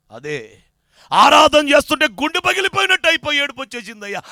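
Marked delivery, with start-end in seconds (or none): 1.1-4.2 loud cry of a broken heart; penitent outcry. Acted. Penitent tells the pastor of weeping, crying out loud.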